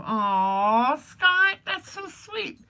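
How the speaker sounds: high, loud delighted aw; touched aw. Real, not acted. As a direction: gush with surprise at the kindness. Touched by viewer's gift offer.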